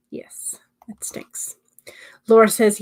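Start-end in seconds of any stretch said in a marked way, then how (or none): 0.1-1.6 whisper. Real, not acted. Whispered sympathy.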